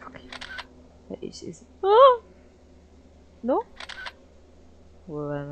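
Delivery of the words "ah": short high scream, much louder; startled shriek. Real, not acted. Gamer shrieking when something jumps out. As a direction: shriek in sudden fright.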